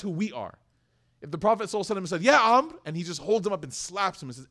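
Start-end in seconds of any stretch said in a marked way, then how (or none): 2.2-2.7 high, loud call-out; angry confrontation. Acted. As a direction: burst out at the offender, mock-furious. Acted angry confrontation with Amr.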